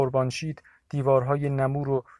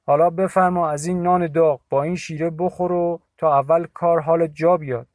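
higher, louder host's invitation; Agha Mashi offering; 4 semitones higher, 7 dB louder than usual. A narrator's reading. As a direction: urge him to eat. (تعارف بلند و گرم)

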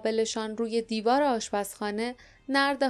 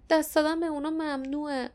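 higher-pitched, understated despair; despairing murmur; 6 semitones higher than usual. A narrator's reading. Tira refuses, having broken laws for them, despairing. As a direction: plead hopelessly in a raised pitch. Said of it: "با ناامیدی زمزمه کرد".

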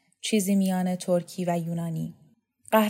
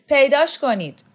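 high, loud command; acted commanding yell; shouted order; 7 semitones higher, 8 dB louder than usual. A narrator's reading. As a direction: shout it as an order to a search party. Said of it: "فریاد کشید".

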